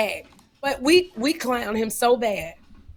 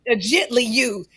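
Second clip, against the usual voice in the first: mocking; 3 semitones higher, 4 dB louder than usual. Mocking the gently used request.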